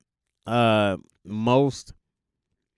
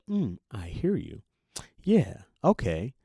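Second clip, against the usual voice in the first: calm listener; slower than usual. Retold or imitated. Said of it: "they're supposed to go".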